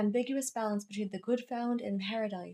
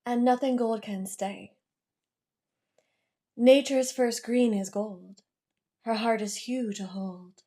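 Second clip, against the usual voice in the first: poem reading. Acted. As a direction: recite verse with deliberate pauses between lines. Second poem read aloud.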